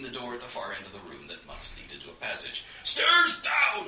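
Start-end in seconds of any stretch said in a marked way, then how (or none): 2.8-3.9 loud, high urgent shout. A narrator's reading. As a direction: yell it while running flat out. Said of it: "Onstag shouted".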